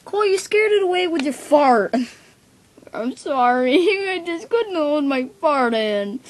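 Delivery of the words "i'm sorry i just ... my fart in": giggling through the apology; laughing. Acted. To his annoyed friend, a laughing apology. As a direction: laugh while saying sorry.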